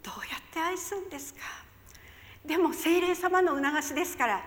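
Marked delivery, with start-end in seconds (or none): 0.0-1.4 whispered plea. Retold or imitated.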